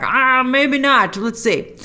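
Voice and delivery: slow, doubtful mock exec; hesitant executive. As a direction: drag it out like a nervous studio boss. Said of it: "a Disney exec will be like".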